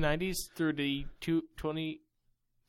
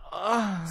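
mock disgusted ugh; 3 semitones higher, 5 dB louder than usual. Mocking the fanfic's sexily.

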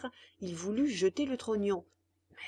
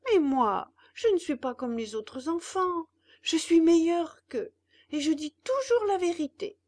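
higher, louder proud promise; boastful puppet; 7 semitones higher, 6 dB louder than usual. Acted. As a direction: swear to be better than others.

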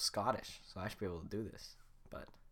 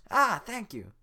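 Donkey voice; 11 semitones higher, 12 dB louder than usual. Acted. Donkey thanks.

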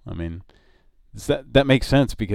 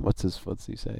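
ordinary talk, nothing marked

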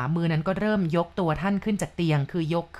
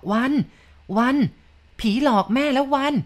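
narrator's raised, higher-pitched cry of alarm; frightened calling out; 7 semitones higher, 4 dB louder than usual. A narrator's reading. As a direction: call out to your son in a startled panic, partly held back. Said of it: "คุณแม่ตกใจอ้าปากกว้าง ร้องตะโกนบอก". (ร้องตะโกนเรียกด้วยความตกใจ)

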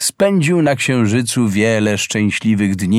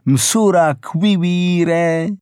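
slow, drawn-out call with a higher pitch; 6 semitones higher, much slower than usual. Acted. Boy calls out slowly to his beloved hare. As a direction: stretch each syllable as a call. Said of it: "Wtedy zawołał".

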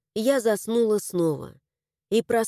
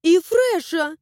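put-on high girl voice; 7 semitones higher, 5 dB louder, slower than usual. A narrator's reading. Flesha calls out.

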